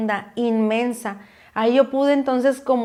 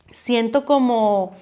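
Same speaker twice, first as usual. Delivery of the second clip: normal talking voice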